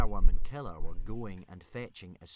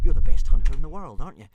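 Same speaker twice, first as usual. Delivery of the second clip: coaxing; 7 semitones higher, 5 dB louder, faster than usual. A narrator's reading. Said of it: "said Hiccup".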